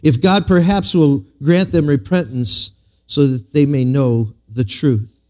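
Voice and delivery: usual speaking voice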